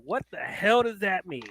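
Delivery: normal talking voice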